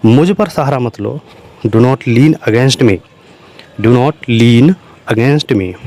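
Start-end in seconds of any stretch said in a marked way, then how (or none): 1.7-5.7 slow dictation. Shown as a demonstration. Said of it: "teacher repeats phrase for learners".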